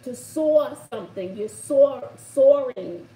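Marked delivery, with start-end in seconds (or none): 1.5-3.1 soaring said loud with a heavy r, "sore-ring"; Brooklyn pronunciation. Shown as a demonstration. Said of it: "How do I say it, Bobby?".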